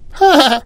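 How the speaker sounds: put-on very high old aunt's cackle. A narrator's reading. Rani's aunt mocking the girl, high-pitched cackle. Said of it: "cackled the Rani's aunt".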